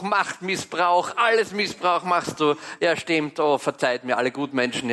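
usual speaking voice

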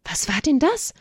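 put-on high-pitched little boy voice; high, wondering question; startled child. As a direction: ask it like an astonished small child. Startled child asks mother.

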